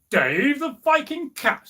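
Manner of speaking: high, slow troll voice; incredulous troll echo. Acted. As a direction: echo the name back, drawn out and high. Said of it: "said the troll".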